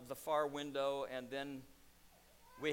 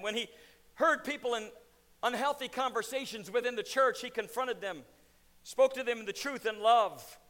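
louder, driving sermon delivery; preaching at full force; 4 semitones higher, 7 dB louder than usual. Acted. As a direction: preach it hard, hammer each challenge. Preaching to pastors and congregation.

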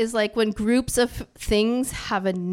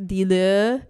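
slow, mock French accent; lightly put-on French accent; 2 semitones lower than usual. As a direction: say it like a French speaker. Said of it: "in a French accent".

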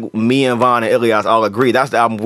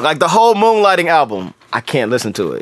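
usual speaking voice

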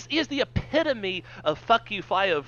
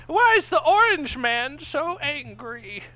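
put-on high, slow old man; toothless; 11 semitones higher, 4 dB louder, slower than usual. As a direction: quaver like a confused old man. Old [name] voice again.

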